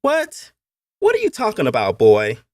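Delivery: put-on very high, loud boy's question; baffled young master. A narrator's reading. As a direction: play a puzzled boy, voice jumping high. Boy baffled by the quotation.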